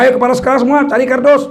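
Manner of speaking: fast, eager command; brisk order. Retold or imitated. Principal orders class heads to grab boxes, fast and eager. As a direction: hurry them off to fetch boxes. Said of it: "kepala sekolah perintahkan".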